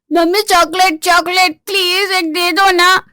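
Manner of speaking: put-on high begging child's voice; little boy nagging mummy for chocolate; whiny child. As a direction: plead like a small kid for sweets.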